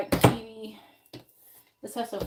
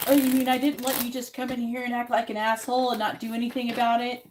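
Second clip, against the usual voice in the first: sarcastic, louder and higher mock retort; mock indignant; 4 semitones higher, 7 dB louder than usual. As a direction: throw their own words back with sarcasm. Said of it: "when people come in and they act rude and then they call me rude".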